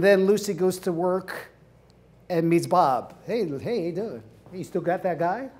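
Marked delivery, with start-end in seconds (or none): 3.2-5.5 soft, low sleazy charm; smooth flirt. Retold or imitated. Bob hitting on Lucy at work, softly sleazy. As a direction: purr the pickup line.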